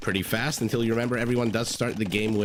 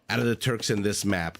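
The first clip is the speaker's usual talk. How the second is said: ordinary talk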